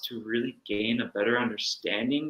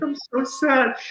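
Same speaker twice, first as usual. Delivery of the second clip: much higher, a hammed-up mopey whine; mock whimper; 13 semitones higher, 5 dB louder, slower than usual. Answering her own critical mind in a mock sad whine. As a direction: play a pouty sad self, lightly.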